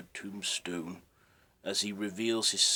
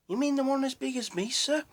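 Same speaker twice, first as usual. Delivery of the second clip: put-on much higher, fast little boy voice; young boy; 6 dB louder, faster than usual. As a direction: chirp it like an eager street boy. Young local boy answers.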